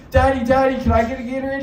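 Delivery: put-on high, loud begging child voice; mocking a kid begging his dad for a Gatorade; whiny kid.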